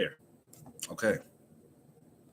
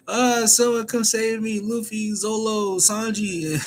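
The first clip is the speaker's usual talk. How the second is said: whiny plea for rescue; 13 semitones higher than usual. Retold or imitated. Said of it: "she's like".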